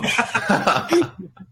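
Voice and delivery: a bright, high burst of embarrassed laughter; high giggle before owning up. Real, not acted. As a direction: laugh it off, a little sheepish. Admits thinking his story is dumb.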